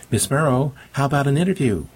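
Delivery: high-pitched hail; calling out. A narrator's reading. Reporter hails departing candidate for interview, calling out. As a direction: call after someone to catch their attention. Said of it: "Grant called out".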